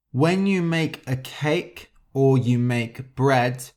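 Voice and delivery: usual speaking voice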